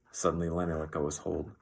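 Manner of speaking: plain reading voice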